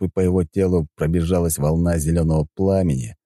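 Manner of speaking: plain reading voice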